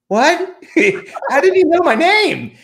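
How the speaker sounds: squeaky high astonished yelp with a laugh; shocked disbelief. Retold or imitated. Stunned when the author greets him by name, very high shocked squeak. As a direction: shriek it, stunned he knows you. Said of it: "And I'm like".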